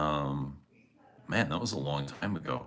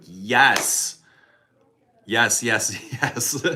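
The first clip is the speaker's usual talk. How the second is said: eager yes; 4 semitones higher, 6 dB louder, much slower than usual. Retold or imitated. Eagerly agreeing to the documentary.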